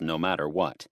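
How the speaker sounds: ordinary delivery, nothing marked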